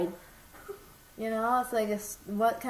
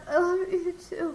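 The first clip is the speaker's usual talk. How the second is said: imitating a teary goodbye, higher with a sob; mock teary; 7 semitones higher than usual. Teasing mom about her phone goodbye, mock crying. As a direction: play back her choked-up farewell, hammed-up. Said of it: "you sound like you're gonna cry".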